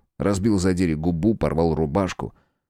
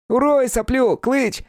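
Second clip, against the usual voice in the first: very high goading cry; excited, high-pitched incitement; egging on; 3 dB louder, slower than usual. A narrator's reading. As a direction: goad your mate into the fight. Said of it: "подзадаривал Плосколицый".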